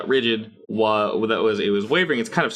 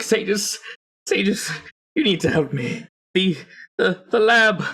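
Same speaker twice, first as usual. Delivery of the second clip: panicked shouting; 9 semitones higher, slower than usual. Acted. Villain begging the sages over the stone, frantic. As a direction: plead frantically for help. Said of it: "shouting into this thing".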